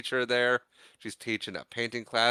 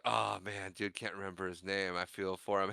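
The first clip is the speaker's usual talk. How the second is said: softer, sympathetic aside; quiet sympathy; 3 semitones lower, 9 dB quieter than usual. Retold or imitated. Worker pities the forgetful visitor, soft aside. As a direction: mutter pity under your breath. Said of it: "the one worker's like".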